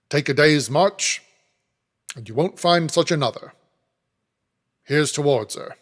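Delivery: terse toast to his wife's birthday; Mr Bagnet's toast. A narrator's reading. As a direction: raise your glass and address the company. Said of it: "addresses the assembled company".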